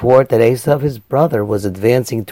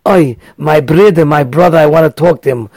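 yearning cry; 4 semitones higher, 6 dB louder than usual. Retold or imitated. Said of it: "I want so much to hug".

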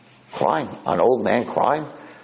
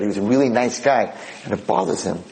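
plain reading voice

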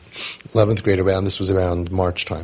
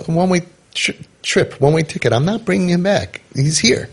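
higher-pitched, retold insistence; insistent refusal; 9 semitones higher than usual. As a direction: dig in, pitched up, as if refusing to budge. Retold firm refusal to take back.

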